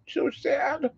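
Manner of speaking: high pouty pet voice. Real, not acted. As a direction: pout at the dog up high. Mock sad baby talk.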